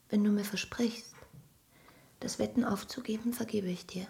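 soft whispered plea; whispered condition. Acted. Wife to gambling husband, gentle whispered condition.

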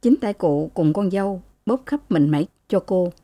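ordinary delivery, nothing marked

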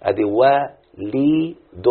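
وليد stretched very slowly into its syllables; slowly spelled out. Shown as a demonstration. Teacher to pupil, spelling his name very slowly. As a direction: draw out وليد beat by beat. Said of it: "فلما اقول مثلا وليد".

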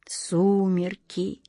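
soft, very slow, dozing off; fading, half-asleep. Acted. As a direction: let the name fade as sleep takes him. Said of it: "затихающим голосом полусонно проговорил старик".

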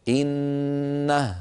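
إنه with the final ه silent, held long and slow; stopped word. Shown as a demonstration. Teacher showing students the stop on إنه. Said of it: "لو وقفنا على إنه نقول".

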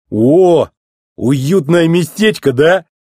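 playful ribbing with a loud "oh"; teasing. A narrator's reading. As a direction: needle your pal, drawing the words out. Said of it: "поддразнил его эвакуатор".

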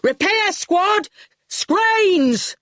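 high, drawn-out bellowed orders; bellowing. Acted. Shine calls the repair squad, bellowing. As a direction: bellow for the crew to come running. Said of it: "Shine was bellowing".

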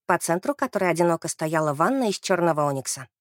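plain reading voice

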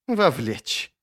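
lightly sneered echo; scoffing. A narrator's reading. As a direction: throw the word back with mild scorn. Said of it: "усмехнулся Сульт".

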